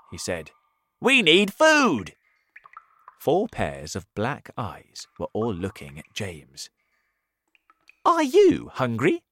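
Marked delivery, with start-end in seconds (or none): 1.0-2.2 high, loud centipede. Acted. Centipede continues. 8.1-9.2 high, loud hungry spider. Acted. Spider asks James.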